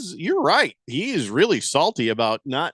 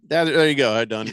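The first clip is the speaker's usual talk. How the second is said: usual speaking voice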